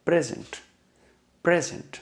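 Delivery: Present said PREZ-unt, focus on PREZ; stress on first syllable. Shown as a demonstration. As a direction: hit the first part of present. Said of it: "इस पे focus देना चाह रहा हूँ".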